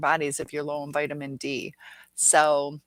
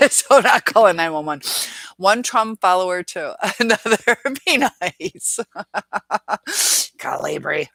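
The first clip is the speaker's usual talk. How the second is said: laughing; 3 semitones higher, 8 dB louder than usual. Real, not acted. Laughing at a chat joke.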